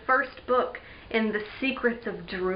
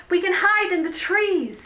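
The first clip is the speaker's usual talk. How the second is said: high-pitched urgent call; yelled; 7 semitones higher, 3 dB louder than usual. Acted. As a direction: call out a plan in a hurry. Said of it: "Eric yelled".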